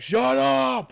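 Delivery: high, very loud outburst; mock furious shout. Parent snapping at one scream, high furious shout. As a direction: yell it as if suddenly losing it. Acting out losing it at noise.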